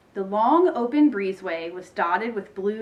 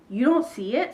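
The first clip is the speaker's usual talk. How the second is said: incredulous question; 4 semitones higher than usual. A narrator's reading. Ivy amazed others see nothing.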